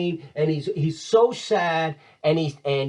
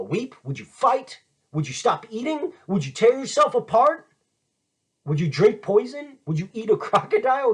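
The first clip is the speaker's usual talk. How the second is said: escalating challenge; 7 semitones higher than usual. Retold or imitated. Hamlet's escalating challenges to Laertes.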